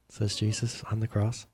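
ordinary delivery, nothing marked

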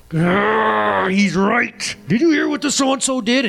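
high, growling mock outrage; riled-up talk radio fan. Pastor mocking his talk-radio self, hammed-up outrage. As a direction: ham up the worked-up listener. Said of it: "and I'm like".

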